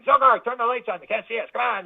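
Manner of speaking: lightly hammed alien chatter; impatient alien. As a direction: play the bored UFO pilot nagging his mate. Aliens voiced waiting to be seen.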